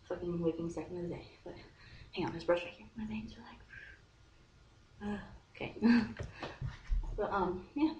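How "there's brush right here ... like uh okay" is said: hushed, trailing aside; distracted whisper. Real, not acted. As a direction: whisper to yourself while distracted.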